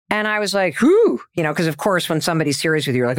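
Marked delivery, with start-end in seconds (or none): 0.8-1.2 high, loud whistling whew; mock whew. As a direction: blow out a startled whew at being told off. Reacting to husband's serious rebuke.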